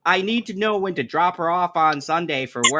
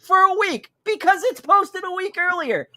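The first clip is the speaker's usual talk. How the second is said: exasperated rant; 13 semitones higher than usual. Retold or imitated. Dad ranting at daughter.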